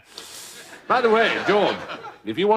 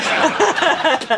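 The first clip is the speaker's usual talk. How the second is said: short hearty laugh; 10 semitones higher, 4 dB louder than usual. Acted. General laughs at explanation.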